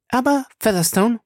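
high woman's voice turning earnest; suddenly serious. Acted. Fay changes the subject with Featherstone, suddenly serious. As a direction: break in with sudden concern. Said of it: "fuhr sie plötzlich ernst fort".

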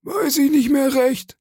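extremely high, squeaky mimicry; mocking echo. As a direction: parrot the sailor's excuse in falsetto. Silver mockingly echoes the sailor's answer.

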